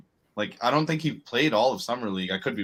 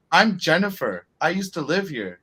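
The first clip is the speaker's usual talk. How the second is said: higher-pitched celebrity introducing herself; lightly imitated famous woman, pitched up; 6 semitones higher, 3 dB louder than usual. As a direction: act out a star expecting to be recognized. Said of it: "[name] going to her".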